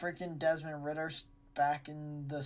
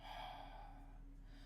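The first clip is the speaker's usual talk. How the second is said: long heavy exasperated sigh; dramatic sigh; 16 dB quieter than usual. Real, not acted. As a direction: let out a long defeated sigh. Weary sigh over bad picks.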